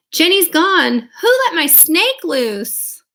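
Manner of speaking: high, loud, panicked cry; Emily's shout. Acted. Emily shouts to the class that her snake is gone. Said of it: "Emily shouts".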